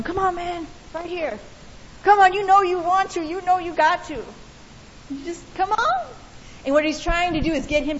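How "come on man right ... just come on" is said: goading, higher and pushy; bully taunt. Retold or imitated. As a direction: egg him on like a schoolyard bully. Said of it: "the guy's just going".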